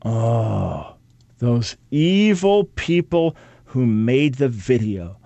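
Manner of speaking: sarcastic gasping horror, slowed and rising; mock outrage. Host mocking critics of the video, sarcastic gasps. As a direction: ham up the shock with gasps.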